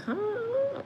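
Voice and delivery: very high, soft, drawn-out "huh"; baffled. Retold or imitated. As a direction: squeak out a puzzled question. Baffled at being sent away.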